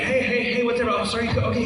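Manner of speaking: high, bright-paced hello to each fan; chirpy autograph greeting. Retold or imitated. Greeting fans at the cattle-call signing, high chirpy hellos. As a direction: rattle off cheerful hellos down the line. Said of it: "you're like".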